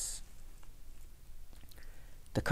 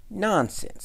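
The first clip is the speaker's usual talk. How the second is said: high, dismissive exclamation; scornful dismissal; scoffing; 12 semitones higher, 4 dB louder than usual. A narrator's reading. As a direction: wave the worry away with a high scoff. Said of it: "scoffed the town mouse".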